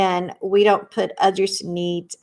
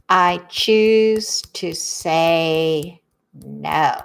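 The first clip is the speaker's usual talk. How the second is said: very slow and lower; a slow, lower and deliberate I choose to say no; slow, low affirmation; 4 semitones lower, much slower than usual. Shown as a demonstration. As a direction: let each word settle, savoring the choice.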